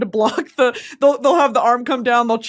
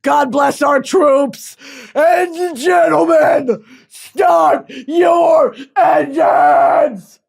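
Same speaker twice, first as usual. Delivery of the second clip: booming, drawn-out arena announcer, higher and much louder; hammed-up announcer shout; 5 semitones higher, 7 dB louder, much slower than usual. Announcing to the troops on base, hammed-up arena shout. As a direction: bellow it like a race-day host hyping the crowd. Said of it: "That was me on base".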